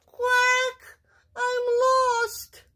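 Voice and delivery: tiny high duckling voice; very high, forlorn little quack; small, sad voice. A narrator's reading. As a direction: squeak it sadly, like a lost duckling. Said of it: "sad voice".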